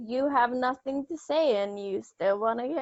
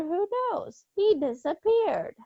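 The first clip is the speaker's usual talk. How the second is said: normal talking voice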